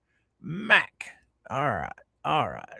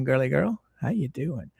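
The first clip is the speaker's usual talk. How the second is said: ordinary talk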